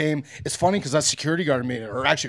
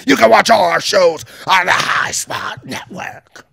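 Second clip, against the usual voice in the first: slow, loud announcer shout, higher; announcer promo; 6 semitones higher, 10 dB louder, slower than usual. Acted. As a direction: boom it out like a wrestling promo. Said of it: "gonna get a promo out of this".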